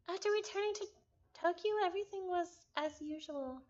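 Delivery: reading the game text aloud, a touch dramatic; visual novel narration. Acted. Streamer reading game text to chat, storytelling.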